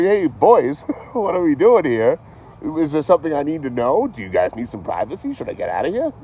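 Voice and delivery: laughing, hammed-up ribbing of the rowdy guys; mocking tease. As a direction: needle the guys with a grin.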